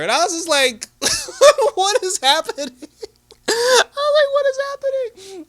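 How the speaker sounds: very high, loud laughing squeal; giddy, high-pitched incredulous outburst; laughing disbelief. Real, not acted. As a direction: shriek it through helpless laughter. Laughing disbelief at the retold threat.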